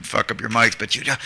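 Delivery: ordinary talk, nothing marked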